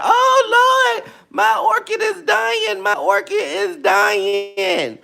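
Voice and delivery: high, wailing cry of despair; hammed-up fretting over a dying orchid; shrill mock panic, drawn out; mock panicked plant owner. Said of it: "Y'all be like".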